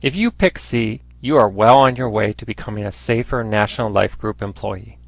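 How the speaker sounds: ordinary talk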